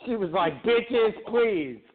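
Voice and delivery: lightly high preacher sermon; cult leader. Acted. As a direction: preach it to the faithful. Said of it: "you sound like a fucking cult leader".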